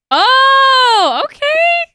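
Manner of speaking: loud, high, drawn-out oh; surprised outburst. Real, not acted. Streamer startled by what the game does, loud surprise. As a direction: burst out in high-pitched surprise.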